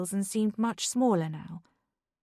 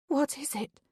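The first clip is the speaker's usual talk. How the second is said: trembling; 6 semitones higher, 3 dB quieter than usual. Acted. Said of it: "Beth's voice trembled".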